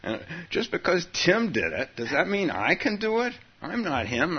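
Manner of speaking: a whiny, doubtful inner voice; high whiny voice. Retold or imitated. Voicing an insecure practitioner.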